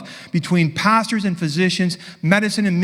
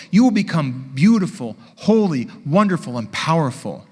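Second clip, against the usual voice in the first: ordinary talk, nothing marked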